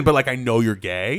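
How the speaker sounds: ordinary talk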